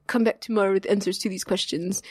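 light imitation of a Normandy French accent; retelling the sommelier's French-accented order in a light French accent; Arnaud's French accent. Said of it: "cute little, like, Normandy French accent".

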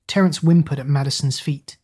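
plain reading voice

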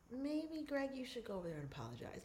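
very soft, private aside; discreet nudge. Acted. Family member nudging Greg to apologize, very softly. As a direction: drop the voice to prompt him quietly.

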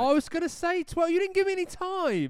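high, loud protest; indignant protest. Real, not acted. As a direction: complain loudly, aggrieved. Protesting a missed quiz answer.

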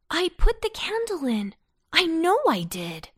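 high-pitched, puzzled protest; boy's baffled insistence; surprised. A narrator's reading. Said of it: "said he in surprise".

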